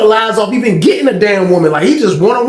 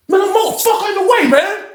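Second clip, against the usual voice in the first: high-pitched mock angry outburst; snapped; 12 semitones higher than usual. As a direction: act out a man losing it, voice way up high.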